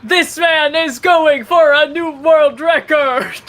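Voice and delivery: hyped-up high shouting commentator; sports announcer. Retold or imitated. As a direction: yell it like a stunt announcer. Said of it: "just think of the video of".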